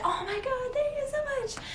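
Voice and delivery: very high, squealing gush; mock an overexcited thank-you; hammed-up gushing squeal; overhyped gift receiver. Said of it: "that overhyped person".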